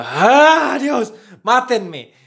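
loud exasperated cry; frustrated outburst. Real, not acted. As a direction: groan out in despair. Frustrated at own drawing.

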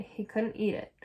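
ordinary delivery

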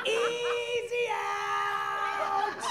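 high, slow drawn-out heckler chant; heckling child. Retold or imitated. Mom imitating toddler heckling her awake like a fan. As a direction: jeer like a fan in the stands, slow. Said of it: "Like he's athletic heckling me".

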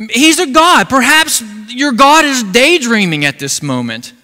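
high, loud taunting sermon; loud scoffing preacher voice; mocking preaching. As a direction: trash talk the false god from the pulpit. Preacher mocks Baal's prophets.

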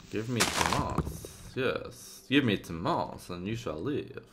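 hint of a hammed-up ruler; mock-commanding voice. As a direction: playfully intone it like a grand demand. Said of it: "I don't know why I'm speaking like this".

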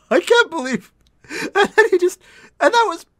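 high, loud, breaking into laughter; can't get it out for laughing; laughing in disbelief. Real, not acted. Reactor stunned by the ending song, laughing in disbelief.

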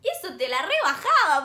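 normal talking voice

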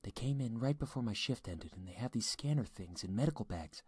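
high, hushed voice of a scared wife; frightened whispering. A narrator's reading. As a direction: confide fearfully, keeping it down. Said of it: "she began whispering".

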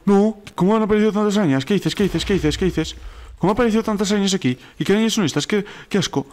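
high-pitched, rattled disbelief; real shock at a sudden swarm; startled disgust. Real, not acted. Spiders suddenly swarm the player.

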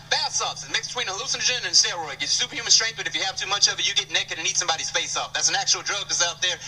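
ordinary delivery

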